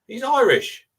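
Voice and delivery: high, loud correction; real high-pitched protest; emphatic correction. Real, not acted. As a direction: jump in loudly to put the co-host right. Correcting co-host's mistake on accent.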